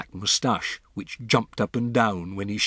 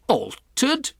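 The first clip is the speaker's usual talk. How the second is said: loud, much higher incredulous shout; 5 dB louder than usual. Acted. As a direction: bark the word back in disbelief. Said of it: "shouted Mister Jenkins".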